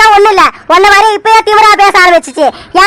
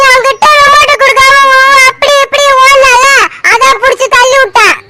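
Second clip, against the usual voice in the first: put-on higher, slow childish voice; child excusing herself; 5 semitones higher than usual. Little Aisu justifying the push to mother, slow sulky child voice. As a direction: drag it out like a sulky little girl.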